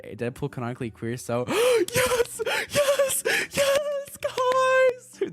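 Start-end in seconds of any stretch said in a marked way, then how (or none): 1.5-5.3 gasp, then very high, loud shrieks; overjoyed fan screaming; ecstatic squealing. Real, not acted. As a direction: squeal it while jumping up and down. Jumping up and down in glee.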